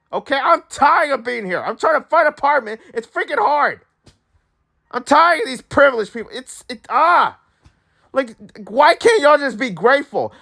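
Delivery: high-pitched, fed-up rant; mad. Real, not acted. Fed-up apartment hunter ranting about privileged people, mad. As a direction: vent your frustration, voice pushed high. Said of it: "I'm so mad".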